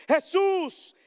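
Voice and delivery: high, loud plea to Jesus; a blind man's loud, high plea for mercy; crying out. Acted. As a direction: call out loud and pleading, reaching upward. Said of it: "gritaron diciendo".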